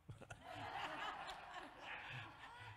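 silent wheezing laugh; breathy laugh. Real, not acted. Preacher laughing at his own story, silent and breathy.

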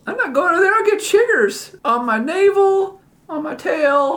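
chatty cartoon cat voice, high; talking cat.

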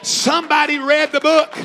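loud, rising preacher climax; fiery preaching. Acted. Preacher declaring victory over the devil to the church. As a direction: drive it home to the congregation.

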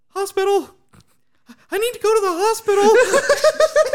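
high, loud mock panic yell, laughing through it; retold panicked yelling. Retelling his cut finger, yelling in hammed-up panic. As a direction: yell for the hospital like a terrified child. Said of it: "I just started yelling".

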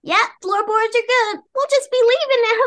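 very high, hurried character voice; excuse-making character. Acted. Stella tries to slip away from Faust, high hurried voice. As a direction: pitch it way up and make excuses.

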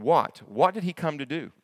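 ordinary talk

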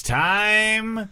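high, drawn-out announcer hype; mock announcer build-up. Performer plugging a monster show, hyped announcer. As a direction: stretch it upward toward a big reveal.

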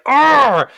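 loud annoyed roar; frustrated growl. Retold or imitated. Frustrated at 99 percent.

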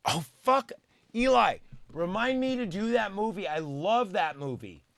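high-pitched burst of enthusiasm; suddenly thrilled, voice jumping up; real excited outburst. Real, not acted. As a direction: gush over a beloved film. Suddenly remembers a beloved movie.

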